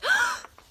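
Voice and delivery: sharp high gasp; Katy seeing the giant dinosaur, gasping. Acted. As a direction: gasp in amazement. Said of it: "Katy gasped".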